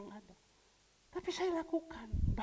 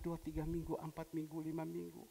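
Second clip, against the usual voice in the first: ordinary talk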